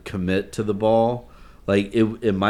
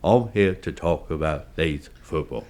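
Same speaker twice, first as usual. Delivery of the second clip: lower-pitched Cockney actor impression; Michael Caine; 4 semitones lower than usual. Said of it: "I love doing my Michael Caine".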